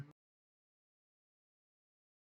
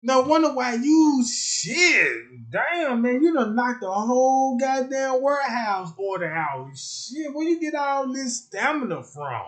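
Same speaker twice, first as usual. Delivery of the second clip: higher, louder amazed exclaiming; amazed boss; 8 semitones higher, 4 dB louder than usual. Retold or imitated. Boss amazed at the worker's output, high and loud. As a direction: marvel at the worker's stamina.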